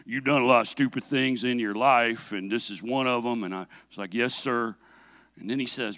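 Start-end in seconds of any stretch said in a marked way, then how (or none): 0.0-3.4 low, hushed stern father; dad's deep voice. Retold or imitated. Father lecturing his son over the ruined gun, deep voice. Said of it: "my dad's got this deep voice". 4.1-4.7 low, subdued obedient son; meek reply. Retold or imitated. Son answering his stern dad, meek and quiet.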